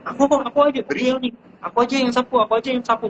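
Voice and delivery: put-on a small child begging to help sweep; high eager childlike voice.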